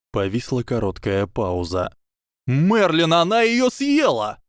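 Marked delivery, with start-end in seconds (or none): 2.5-4.4 much higher, louder shocked cry; shocked exclamation. Acted. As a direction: blurt out the horror. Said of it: "воскликнул Теодор Нот". (потрясённый возглас, высоко и громко)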